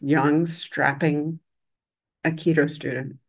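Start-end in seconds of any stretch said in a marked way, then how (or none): none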